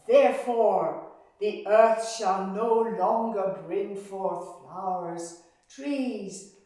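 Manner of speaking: loud, furious goddess pronouncing a curse; furious decree. Acted. Furious Demeter cursing the earth with endless winter. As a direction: thunder out the curse in rage. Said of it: "she was furious".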